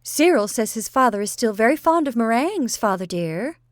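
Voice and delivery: an aunt chirping loudly to her deaf father; bright. A narrator's reading. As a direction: brightly cry it out to the old man. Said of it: "She cried brightly".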